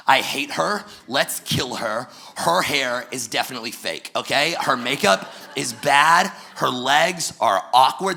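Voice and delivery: husband overplaying his loyalty with loud mock scorn; mock outrage. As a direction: pile on the insults like a jealous best friend. Husband mock-siding with wife, trash talk.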